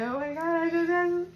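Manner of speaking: real emotion breaking through; choked up. Real, not acted. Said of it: "Now I'm gonna cry".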